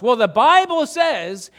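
big mock-authoritative quote; emphatic proclaiming. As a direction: proclaim it like a street preacher, drawn out. Acted preachy Bible quote to strangers.

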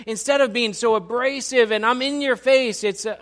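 high, loud sermon delivery; forceful preaching. Real, not acted.

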